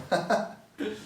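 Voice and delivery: short high laugh, real and open; burst of laughter. Real, not acted. Laughing at himself as the odd guy from the neighbourhood. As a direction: laugh at your own odd-sneaker confession.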